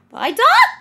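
high, loud protest; angry outburst. Pretend. Streamer stuck in game, mock-angry shouting. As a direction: cry out in hammed-up frustration. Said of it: "I'm angry".

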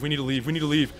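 loud, high urgent protest; panicked plea. Pretend. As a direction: beg the team to back off. Urging team to flee.

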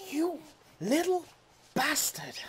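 high angry curse; furious insult. Real, not acted. Gamer cursing the thief that took his crop. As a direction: spit the insult out.

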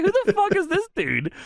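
very high, incredulous squeal; retold disbelief. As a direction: replay your baffled first reaction. Retelling own disbelief on first seeing him.

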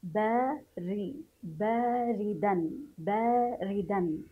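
باردا very slow, syllable by syllable with "ri"; kasra on the ra. Shown as a demonstration. Teacher shows breaking the letter when reading, slow kasra demo. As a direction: stretch باردا out as "baa-ri-dan". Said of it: "سنكسر الحرف عند قراءته".

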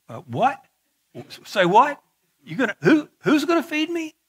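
hammed-up double take at being fed by ravens; Elijah baffled. Elijah hearing ravens will feed him, incredulous and laughing. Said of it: "I could just imagine Elisha going".

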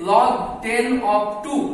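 very slow reading aloud. Shown as a demonstration. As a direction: stretch out each English word very slowly. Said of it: "যখনই তোমার লেখা হচ্ছে". (খুব ধীরে ধীরে পড়ে শোনানো)

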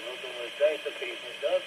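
ordinary delivery

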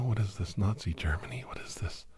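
ordinary delivery

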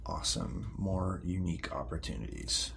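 low, soft, deadpan sarcasm; sarcastic. Acted. Teen mocking the therapist's idea, sarcastic. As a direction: mutter it dryly, unimpressed.

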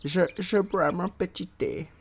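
lightly put-on English accent; mock English accent. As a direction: play at being English over tea. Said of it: "je fais comme les Anglais".

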